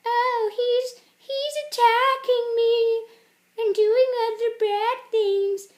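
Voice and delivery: loud, high, slow mock wail; wailing teenager. Teenager calling out that she's attacked, high loud wail. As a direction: stretch it out as a shrieky hammed-up complaint.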